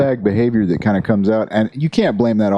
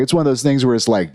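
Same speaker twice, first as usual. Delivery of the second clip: ordinary talk, nothing marked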